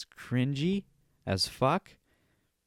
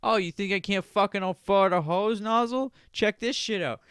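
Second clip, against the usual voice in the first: higher, showy mock taunt; gloating rich neighbour; 8 semitones higher, 4 dB louder than usual. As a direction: taunt them like a rich show-off. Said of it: "He's like".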